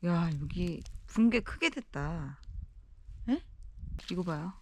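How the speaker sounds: usual speaking voice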